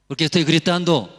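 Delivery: loud, high, rapid question; real shouting. Real, not acted. Preacher asking listeners why he shouts, real shouting. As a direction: fire it out as a shout.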